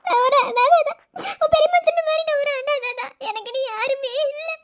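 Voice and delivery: crying hard, gasping between phrases; anguished tearful outpouring; sobbing confession. Acted. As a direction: plead and confess through tears. Sobbing confession of being an orphan.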